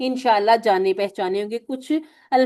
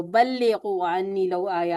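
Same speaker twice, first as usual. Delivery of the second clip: Arabic hadith recitation; 3 semitones lower, slower than usual. Shown as a demonstration. Teacher quotes hadith in Arabic.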